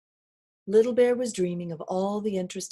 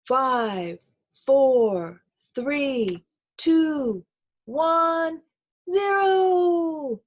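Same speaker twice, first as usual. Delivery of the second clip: building countdown; 7 semitones higher, 6 dB louder, much slower than usual. Acted. Counting down to takeoff.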